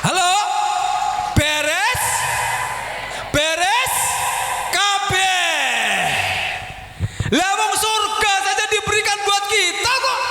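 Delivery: high, shouted rallying proclamation; triumphant pulpit cry, drawn out at the climax; full-force preaching. Real, not acted. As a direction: rouse the congregation with a ringing shout.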